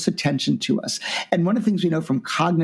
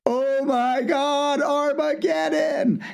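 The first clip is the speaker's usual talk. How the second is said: much higher, very slow dramatic dread; mock panic; 11 semitones higher, much slower than usual. Getting a vague message from the boss, in mock panic. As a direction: wail it like the world is ending. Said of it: "Then you're like".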